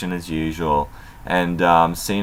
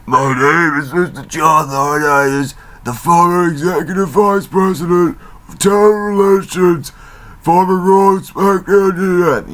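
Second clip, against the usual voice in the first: higher, pompous self-introduction; mock John Laurinaitis; 10 semitones higher, 4 dB louder than usual. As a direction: puff up like a stiff corporate suit. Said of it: "he says".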